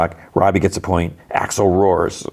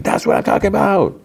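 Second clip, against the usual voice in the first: high-pitched mock scream of cheering; imitated screaming; 14 semitones higher than usual. Amanda cheering on the fighter, screaming in imitation. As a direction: squeal it out like an over-excited fan, not full volume. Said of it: "like, screaming".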